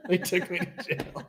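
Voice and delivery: chuckling through the words; real laugh at own story. Real, not acted. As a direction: laugh as you say it.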